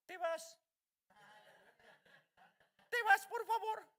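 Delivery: a hammed-up timid plea to the devil; timid soft pleading. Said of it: "no puede hablarle bajito al diablo". (súplica tímida y burlona, aguda)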